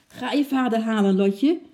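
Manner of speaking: high, loud instruction thrown behind; calling over the shoulder. A narrator's reading. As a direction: call it back while hurrying off. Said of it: "riep oom Nijltje over zijn schouder".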